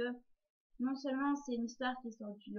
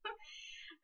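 laugh; 7 semitones higher, 9 dB quieter than usual. Real, not acted. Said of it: "un jour et demi pour charger".

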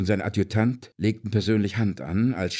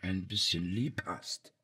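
pleading softly; 9 dB quieter than usual. Acted. Kneeling tyrant begs for love.

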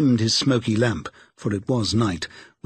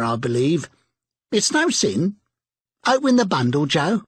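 defiant charwoman; 5 semitones higher than usual. A narrator's reading. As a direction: toss it out boldly.

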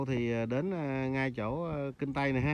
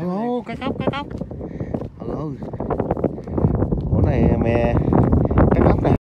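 excited shout; 3 semitones higher, 15 dB louder than usual. Real, not acted. Shouting about the fish he caught.

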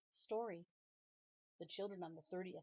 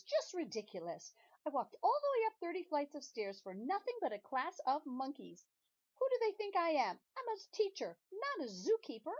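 higher, louder, rising outrage; indignant rant; 8 semitones higher, 7 dB louder than usual. Acted. Missus Jules rants at the children, indignant and loud. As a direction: build the huff to a peak. Teacher indignant at monkey class.

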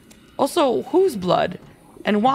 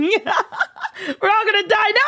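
slow, high, laughing doom cry; hammed-up panic, drawn out; mock doom wail; 7 semitones higher, slower than usual. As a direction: pretend everyone's doomed, laughing. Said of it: "We do not shout".

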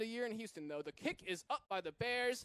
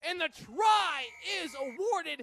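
excited announcement; 7 semitones higher, 8 dB louder, slower than usual. Real, not acted. Try scored, commentator announces.

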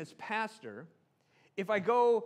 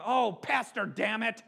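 loud, raised exasperated outburst; mock angry cursing; 5 semitones higher, 7 dB louder than usual. Pastor imagines his name used as an expletive, mock-angry outburst. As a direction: curse loudly in hammed-up frustration. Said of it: "as an explicative when you were angry".

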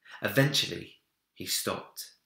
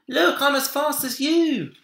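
put-on high, loud gleeful child's shout; excited cry; 9 dB louder than usual. A narrator's reading. Minik skids over the ice on Big Bear's back, loud and gleeful. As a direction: whoop it while sliding. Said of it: "Minik cried".

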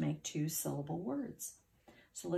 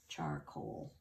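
soft lowered "Charcoal"; 14 semitones lower, 6 dB quieter than usual. Shown as a demonstration. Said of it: "final word said".

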